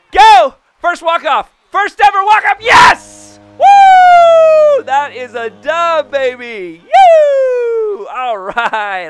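screaming high and loud in pure joy; ecstatic screaming. Real, not acted. Gamer screaming after hitting his first walk-off. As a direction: lose it completely over the first walk-off. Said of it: "Sorry if that was kinda loud".

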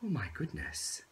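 ordinary delivery

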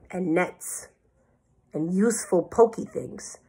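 plain reading voice

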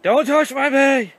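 high, loud squawky mock chicken; voicing a chicken. Voicing the angry chicken, high and loud. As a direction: shriek it as the chicken. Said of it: "These chickens are like".

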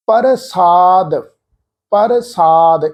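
ਪ੍ਰਸਾਦ split as "par-saad", full vowel after the P; mistaken pronunciation. Shown as a demonstration. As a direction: say ਪ੍ਰਸਾਦ wrongly, separating P and R. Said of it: "ਇਸ ਢੰਗ ਨਾਲ". (ਪ੍ਰਸਾਦ ਨੂੰ "ਪਰ-ਸਾਦ" ਵਾਂਗ ਤੋੜ ਕੇ)

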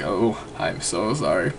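low, slow, hushed mock apology; sheepish, drawn-out low murmur; embarrassed apology. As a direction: imitate mortified parents apologizing quietly. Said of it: "like, hella embarrassed".

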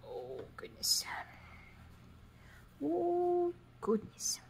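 high gasp then whispered goodness; flustered whisper. Real, not acted. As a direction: breathe out a startled oh goodness.

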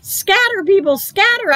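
high frantic yell. A narrator's reading. Kid yelling at people to flee from a witch, high frantic shout. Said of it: "I yelled".

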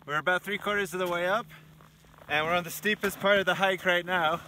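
normal talking voice

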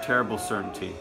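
usual speaking voice

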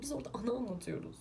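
fast, hushed whispered aside. Real, not acted. Vlogger insists to viewers in a quick whisper. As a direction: let it slip out under your breath. Aside while defending her video.

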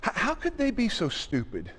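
hushed mock astonishment; quiet incredulous aside; quiet disbelief. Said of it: "we're like".

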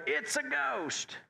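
high, drawn-out mock cry of fear; imitowany okrzyk strachu. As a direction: stretch it out high, hammed-up group cry. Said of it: "They didn't in unison go".

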